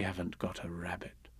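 whispered ending. A narrator's reading.

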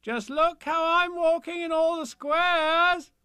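high, loud, triumphant chant; calling out. A narrator's reading. Child calls to bears from the squares, high boastful shout. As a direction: taunt them loudly, drawing out the last word. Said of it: "and I say to them".

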